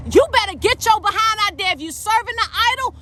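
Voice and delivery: high, urgent preaching burst; forceful warning. Real, not acted. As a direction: fire it out like a scolding preacher. Bursts back out of mock soft tone.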